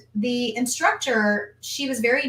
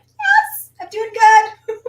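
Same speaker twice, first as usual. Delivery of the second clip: strained high reply; 12 semitones higher, 9 dB louder, much slower than usual. Retold or imitated. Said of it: "And I'm like".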